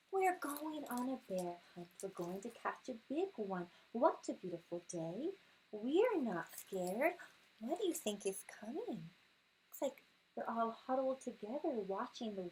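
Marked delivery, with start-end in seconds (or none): none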